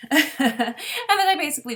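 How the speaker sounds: chuckling through the words; laughing. Real, not acted. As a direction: laugh while saying it. Laughs while describing waiting.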